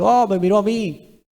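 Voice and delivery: high, starstruck mock gasp of delight; a preacher mimicking a starstruck man who was looked at; imitating a thrilled fan. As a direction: play a dazzled fan, lightly mocking. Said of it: "estaba feliz y decía".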